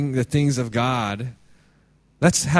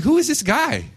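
much higher, fast indignant mock protest; offended listener; 7 semitones higher, faster than usual. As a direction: huff like a churchgoer insulted from the pulpit. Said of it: "We're gonna be like".

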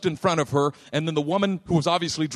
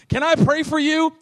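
high, louder mock-timid question; tentative offer; 11 semitones higher, 5 dB louder than usual. Preacher re-enacting his offer to the injured woman, high and tentative. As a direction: ask high over the commotion.

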